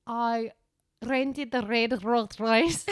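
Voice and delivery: slow drawled mock Australian with rolled R's; Australian accent stretched out, R's rolled; fast Australian. Shown as a demonstration. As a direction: roll "rented the red Rolls Royce" heavily. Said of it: "you have to say it like".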